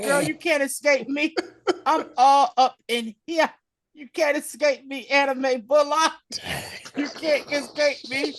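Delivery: high-pitched, hammed-up controlling threat. Villain taunts the clone he controls, mock-menacing. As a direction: taunt in a high put-on voice, half laughing. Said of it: "He pulled the [name]".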